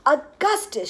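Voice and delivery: high, loud scolding call; shouting. A narrator's reading. Mother to her son at the river, shouting. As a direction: shout his name. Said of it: "shouted Missus Gloop".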